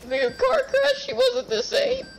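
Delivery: a hammed-up tearful squeak, laughing through it; mocking whiny voice. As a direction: imitate a friend's teary story, squeaky and hammed-up. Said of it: "You sounding all".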